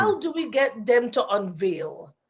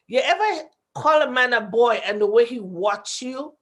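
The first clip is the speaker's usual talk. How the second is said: ordinary delivery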